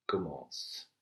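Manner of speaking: usual speaking voice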